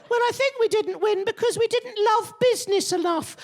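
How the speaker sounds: very high, silly mimicking voice; squeaky caricature of a party excuse; mocked Labour politician. Comedian mimics Labour explaining election defeat, high mocking voice.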